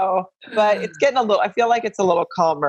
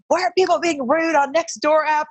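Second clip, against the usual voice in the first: exasperated complaining; 10 semitones higher than usual. Retold or imitated. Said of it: "And I was just like".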